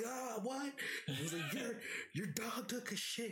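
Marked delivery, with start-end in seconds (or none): 0.0-0.7 high shouted what; shouting back. Retold or imitated. Roommate can't hear him, high shouted reply. 1.5-3.3 retold yelling. Repeating the yell.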